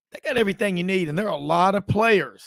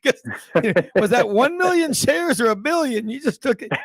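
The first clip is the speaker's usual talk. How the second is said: panicked trader voice; 6 semitones higher, 4 dB louder than usual. Retold or imitated. Imitating a panicked stock trader.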